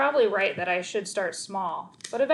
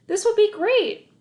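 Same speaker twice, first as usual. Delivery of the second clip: high, loud eager reassurance; reassuring; 12 semitones higher, 8 dB louder than usual. Acted. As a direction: sell it with forced cheer. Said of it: "I assured him".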